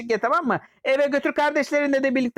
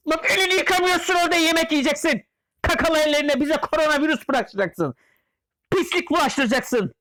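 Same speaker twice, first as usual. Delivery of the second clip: scolding yell; 6 semitones higher than usual. Acted. Scolding the character.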